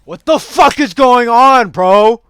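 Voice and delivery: very loud, high, drawn-out disbelief; exasperated yell. Real, not acted. Gamer bewildered by the match, exasperated yell. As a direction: holler it slowly in total confusion. Confused outburst in game.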